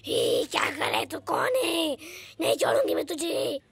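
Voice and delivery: low, quiet growling witch; deep witch voice. Acted. Witch snarling at the confused father, low growl. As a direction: menace him in a low growl.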